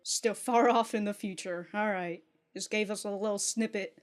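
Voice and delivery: normal talking voice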